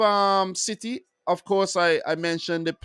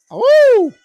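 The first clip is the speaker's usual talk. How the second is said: excited woo; 12 dB louder than usual. Real, not acted. Cheering his team Wolves.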